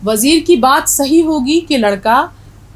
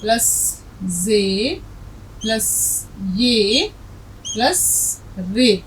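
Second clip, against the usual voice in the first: letter by letter, very slow; slow dictation of letters; slow spelling out; 7 dB quieter, much slower than usual. Shown as a demonstration. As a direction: spell each letter out slowly for students. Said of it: "اب دیکھیے، یہ لفظ جو ہے".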